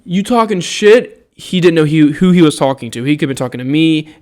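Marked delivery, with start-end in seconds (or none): 0.0-1.2 lightly higher, louder taunting voice; retelling the guy's challenge, mildly mocking. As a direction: quote his challenge with a sneer. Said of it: "So whenever he said".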